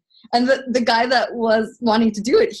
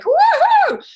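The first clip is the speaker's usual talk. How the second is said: much higher, louder whoop of glee; excited whoop; 8 dB louder than usual. Retold or imitated. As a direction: whoop like riding a roller coaster. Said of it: "you're like".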